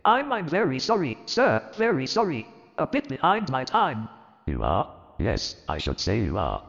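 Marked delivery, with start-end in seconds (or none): 0.1-4.1 much higher, stammering apology; nervous clerk. Acted. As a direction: voice a timid clerk begging pardon. Bob apologising for lateness.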